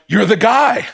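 mock indignant skeptic; mocking accusation. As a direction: needle him for bragging. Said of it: "Like you're going".

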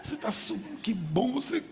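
squeal-like praise kept soft, imitating supportive friends; gushing girlfriends. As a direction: play the encouraging girlfriend in a high, light voice. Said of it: "As colegas".

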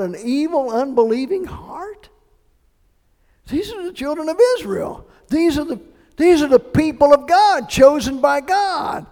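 loud, high, building preacher's cry; forceful preaching. Real, not acted. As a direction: proclaim it to the whole church. Preacher raising voice to crowd.

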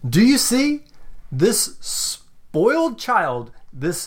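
high, slow proclaiming shout; theatrical yell. Pretend. Villain yelling from down below, theatrical shout. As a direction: denounce to the crowd in a high, drawn-out yell. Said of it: "down below yelling".

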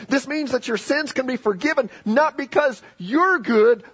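high, urgent pulpit proclamation; fervent sermon climax; impassioned preaching. Real, not acted. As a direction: lift the pitch and drive each phrase home.

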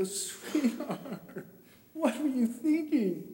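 high, choked-up parent imitation; retold sobbing. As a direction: play a sobbing dad questioning his child. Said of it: "I'm sobbing".

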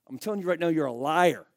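usual speaking voice

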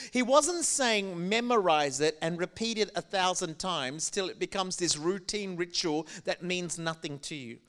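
normal talking voice

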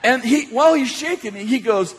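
normal talking voice

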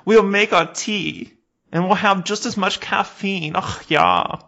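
high, loud put-on German accent; smug German tourist gloating; German tourists bragging about their disguise; mock German accent.

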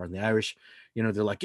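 ordinary talk, nothing marked

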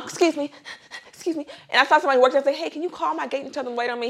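high-pitched retold plea while rushing; frantic pleading. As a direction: act out hurrying past, pitched well up. Acts herself rushing through the airport.

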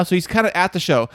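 ordinary talk, nothing marked

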